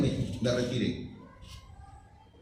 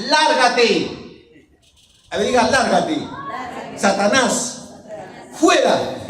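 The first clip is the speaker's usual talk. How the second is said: loud, higher-pitched commanding rebuke; forceful preaching; 8 semitones higher, 7 dB louder, slower than usual. Acted. Preacher quoting Jesus banishing Satan, commanding shout. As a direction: cast Satan out with each word.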